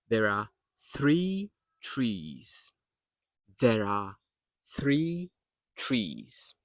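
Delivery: slow "three trees", th in "three" against t in "trees"; three versus trees contrast. Shown as a demonstration. Teacher contrasting three and trees, slow careful articulation. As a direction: separate "three" from "trees" word by word. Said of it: "So you have the tree".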